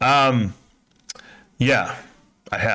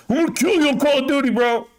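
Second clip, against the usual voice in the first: mock gamer taunt; 10 semitones higher than usual. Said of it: "nothing better to say than".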